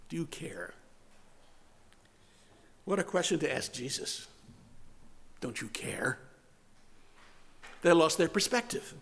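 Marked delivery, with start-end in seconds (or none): 0.1-0.7 near-whispered, soft and low question; hushed. Retold or imitated. Preacher echoing the disciples' question to Jesus, hushed. 5.4-6.2 soft, low echo of the question; hushed. Retold or imitated. Preacher repeating what the disciples asked Jesus, hushed.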